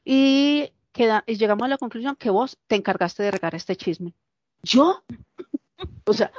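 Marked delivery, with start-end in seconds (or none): 4.6-6.3 sharp high indignant Me?; indignant disbelief. Retold or imitated. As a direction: fling it back, stunned and offended.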